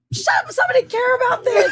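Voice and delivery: high, loud laughing shout; excited shout. Retold or imitated. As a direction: yell it with delighted disbelief. Retelling excited yelling at coworker.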